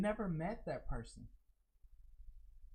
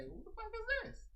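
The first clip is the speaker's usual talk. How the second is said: high, disbelieving question; incredulous inner voice; 13 semitones higher than usual. Retold or imitated. Streamer voicing her own thought about a newcomer, high and incredulous. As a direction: squeak it out in disbelief. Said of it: "Same thing I say".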